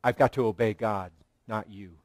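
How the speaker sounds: wife firmly tells husband she obeys God; firm quiet refusal. Retold or imitated. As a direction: quietly hold the line before a husband. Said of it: "when it has to say to a husband".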